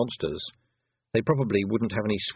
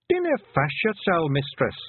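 higher Scots pixie voice, unbothered; Rob Anybody, Scots pixie; 8 semitones higher than usual. A narrator's reading. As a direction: brush off her worry in broad Scots.

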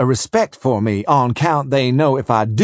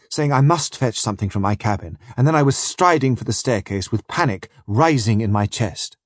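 ordinary delivery

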